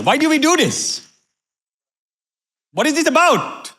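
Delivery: high, full-force preacher's cries; fiery preaching. Acted. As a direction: fire up the congregation with each question. Preacher builds fiery questions.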